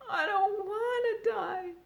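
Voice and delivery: high, slow tearful moan. A narrator's reading. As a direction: sob the words out. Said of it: "he moaned".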